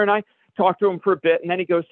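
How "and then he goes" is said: normal talking voice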